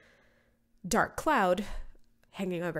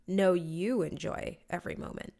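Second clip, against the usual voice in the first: usual speaking voice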